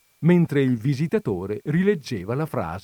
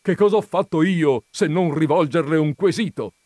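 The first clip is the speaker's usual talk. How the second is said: loud, higher, self-justifying question; triumphant; 7 semitones higher, 8 dB louder than usual. A narrator's reading. Visitor justifying his question to her, triumphant. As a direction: press home the winning point. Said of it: "in tono di trionfo".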